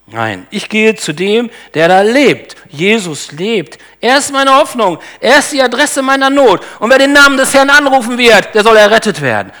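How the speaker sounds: building confession. Acted. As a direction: build from calm resolve to a ringing proclamation. Preacher voices a believer's confession.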